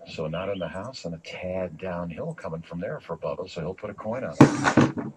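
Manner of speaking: measured live golf call; golf commentator. Acted. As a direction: call the putt for broadcast. Golf broadcast play-by-play.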